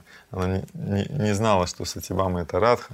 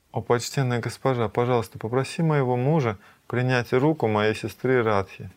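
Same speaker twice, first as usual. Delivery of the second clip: ordinary talk, nothing marked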